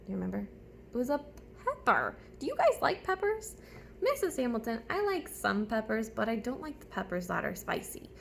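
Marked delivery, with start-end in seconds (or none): none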